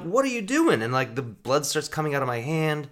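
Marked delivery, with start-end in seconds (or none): 0.0-0.8 high, louder disbelieving question; alarmed question. Retold or imitated. Stabbed guest asking the attacker with high alarm. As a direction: demand an answer in shock. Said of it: "I'm like".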